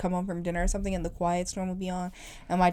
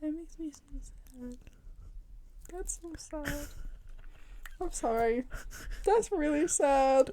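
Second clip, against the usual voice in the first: soft, higher, breathy pity voice; mock sad whisper; 6 semitones higher, 10 dB quieter, slower than usual. Friend hams up pity for her co-host, soft and high. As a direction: coo over her like it's tragic. Sympathizing over no trick-or-treating.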